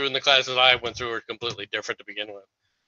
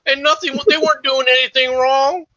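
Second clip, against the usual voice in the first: high, mocking sing-song parody of the co-host; mocking imitation of co-host; 10 semitones higher than usual. Mocking the co-host's argument in a high whiny parody voice. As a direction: mimic the other guy's whining to make fun of him. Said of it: "is that your attempt at a Southern accent".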